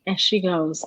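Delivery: ordinary talk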